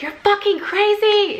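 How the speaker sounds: very high-pitched retold outburst; outraged yell. After he took her iPad away, outraged yell. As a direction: hurl it at him, voice shooting up.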